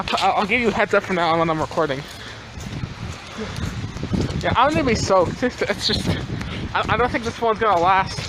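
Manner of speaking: ordinary delivery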